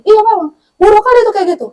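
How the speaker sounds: high, emphatic mock indignation; indignant agreement. Mother validating her upset child, indignantly agreeing. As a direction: side with the venter, pitched well up.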